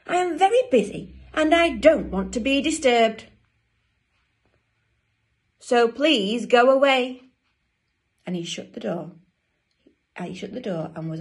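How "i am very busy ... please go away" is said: loud, higher, emphatic dismissal; cross penguin. Acted. Busy Dudley to his sisters, loud cross dismissal. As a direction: send them away word by word.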